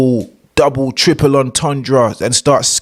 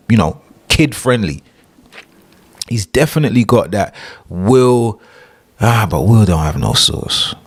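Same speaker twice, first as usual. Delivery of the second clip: ordinary talk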